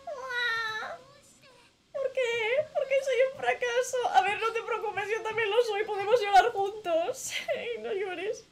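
high, loud fake blubbering through laughs; hammed-up sob, voice cracking high; mock sobbing. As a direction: bawl along, half laughing.

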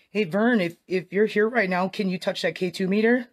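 high, loud call into the dark; calling out to a ghost. Real, not acted. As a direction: call out to the spirit, slowly at the end.

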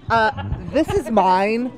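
slow, haughty snooty drawl. Pretend. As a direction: lord it over them, drawn out. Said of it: "I don't have to put on the snooty voice often".

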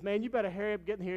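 high, loud, fast friendly warning. Retold or imitated. Friend at Wendy's warns him of the bus, fast and loud. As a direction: hurry him along with a grin. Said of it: "And he goes".

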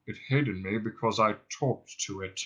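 low, self-pitying drone; depressed robot. Acted. Marvin accuses Ford of hating him, morose drone. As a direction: drone it wearily, without hope.

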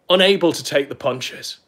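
usual speaking voice

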